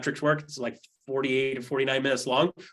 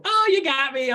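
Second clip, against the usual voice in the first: high, loud mocking give-up; a dog pretending to be caught, raised and loud; mock surrender; 10 semitones higher, 5 dB louder than usual. Sarcastic dog to its chaser, mock surrender high and loud. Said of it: "Does the dog go".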